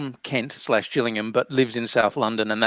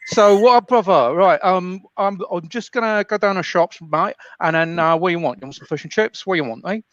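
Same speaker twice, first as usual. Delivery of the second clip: loud, high South London geezer; cheeky South London lad, pitched up; Kent cousin accent; 5 semitones higher, 4 dB louder than usual. Acted. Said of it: "I can do my accent, which is actually my cousin".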